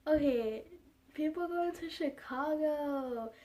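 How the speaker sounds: lightly voiced airport announcer, slow and cheerful; cheerful gate announcer. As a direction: sing it out like a happy gate agent. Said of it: "the lady was like".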